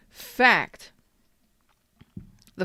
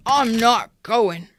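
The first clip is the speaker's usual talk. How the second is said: slow, louder, drawn-out pouty refusal; stubborn refusal; 4 semitones higher, 6 dB louder, much slower than usual. Retold or imitated. Reader mimics a stubborn Cancer refusing, slow pouty. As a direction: dig in your heels and refuse. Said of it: "And you're stubborn about it".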